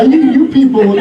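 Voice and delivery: ordinary talk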